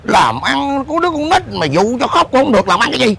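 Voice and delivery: acted exasperated rebuke; scolding.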